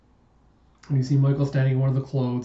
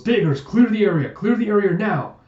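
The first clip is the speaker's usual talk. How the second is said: high, loud urgent order; commanding, raised-pitch shout; 8 semitones higher, 6 dB louder than usual. Acted. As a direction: yell an evacuation order to the squad. Commander orders the area cleared.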